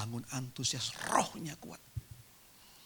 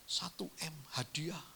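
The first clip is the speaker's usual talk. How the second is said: breathless whisper over a windfall; awed whisper; 3 semitones higher, 7 dB quieter than usual. Pretend. Preacher to congregation, imagining a windfall, whispering in awe. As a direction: whisper it like you can't believe the money. Imagining a billion-rupiah transfer arriving.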